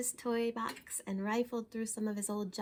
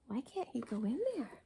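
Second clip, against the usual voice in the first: ordinary delivery, nothing marked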